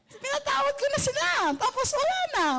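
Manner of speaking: very high, bright and unbothered; cheerful declaration. Retold or imitated. Preacher telling the vendor she forgave them, very high and cheerful. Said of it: "Sabi ko".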